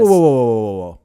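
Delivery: loud sudden whoa, then fading off; startled whoa. Real, not acted. Cohost halts the host on The Secret, loudly surprised. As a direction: stop him short in surprise, loud then trailing.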